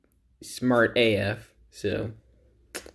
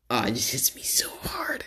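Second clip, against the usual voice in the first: softly whispered cringe; 7 dB quieter than usual. Real, not acted. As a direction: whisper it, embarrassed. Cringing at his old video.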